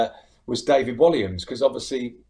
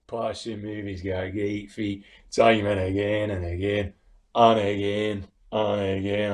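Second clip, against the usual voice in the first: Alan Hansen; 3 semitones lower than usual. Retold or imitated. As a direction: dwell heavily on each damning word. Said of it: "that sort of voice".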